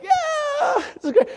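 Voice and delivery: high, strained yell back; mock shout across the water; yelling to be heard over an engine; shouting over the boat. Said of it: "You can't hear the thing".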